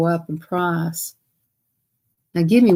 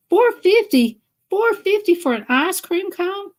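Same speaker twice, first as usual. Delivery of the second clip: the price repeated in outrage, much higher; shocked disbelief; 11 semitones higher than usual. Real, not acted. Vlogger balks at the ice cream price, shocked. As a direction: pitch jumps high on the repeated "Four fifty".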